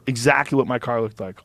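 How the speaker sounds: normal talking voice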